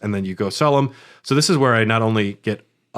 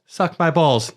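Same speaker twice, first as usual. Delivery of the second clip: higher, kindergartner repeating a line; imitating a small daughter; 6 semitones higher, slower than usual. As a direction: parrot it back in a higher little-girl voice. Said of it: "his daughter ... do the lines for the kindergartners".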